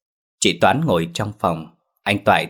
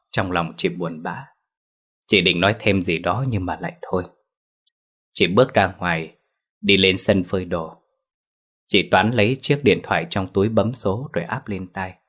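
ordinary delivery

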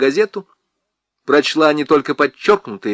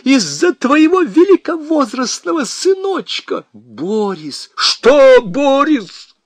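much higher, loud, furious; shrieking blame; 10 semitones higher, 4 dB louder than usual. Acted. Husband screeches blame at his wife. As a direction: screech every accusation.

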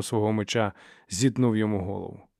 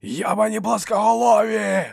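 high, loud, drawn-out war cry; battle roar; 11 semitones higher, 5 dB louder than usual. A narrator's reading. Dow charges out at the flatheads, roaring. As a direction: bellow the insult as you charge. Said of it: "заволав він".

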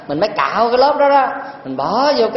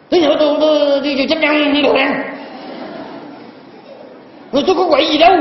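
put-on drunk man protesting; 6 semitones higher, faster than usual. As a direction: bluster like a tipsy man at the temple gate. (giọng ông say xỉn cãi lại, cao và to)

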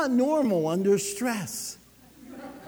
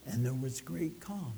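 much softer and lower; hushed; 9 semitones lower, 12 dB quieter than usual. Acted. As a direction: let it fall into a quiet calm. Sermon climax, calm.

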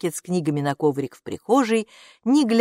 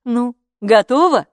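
raised, higher questioning call; calling through a door; 7 semitones higher, 8 dB louder than usual. A narrator's reading. Mama asks from behind the door, raised calling voice. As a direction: call out to check on them. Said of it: "спросила из-за двери мама".

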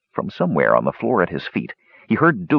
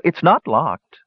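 teasing woman's voice; 8 semitones higher, slower than usual. A narrator's reading.

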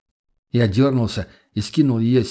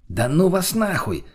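high, drawn-out exasperation; indignant dismissal; 7 semitones higher, slower than usual. A narrator's reading. Friend cursing off the couple, high and indignant. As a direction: stretch and lift the middle in outrage. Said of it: "возмутился тот".

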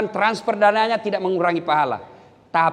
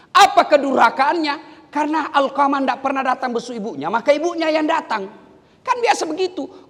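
high, raised-voice sermon storytelling; forceful preaching; 9 semitones higher, 3 dB louder than usual. Real, not acted. Preacher explaining Alqamah's sin against his mother, high and emphatic. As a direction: press the question, then answer it emphatically.

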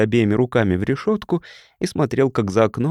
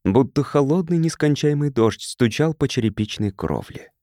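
ordinary delivery

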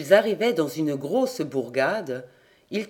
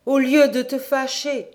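higher, lighter queen's voice; 8 semitones higher, 5 dB louder than usual. A narrator's reading. Queen pulling her angry husband's arm, higher coaxing voice. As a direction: coax the husband, voice raised high.

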